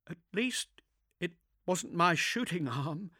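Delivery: put-on high, pained young woman; wincing through pain. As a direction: force the words past the pain. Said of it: "wincing through the pain".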